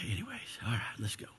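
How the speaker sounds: whispered aside regaining composure. Real, not acted. Pastor whispering while pulling himself together. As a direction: breathe it out, collecting yourself.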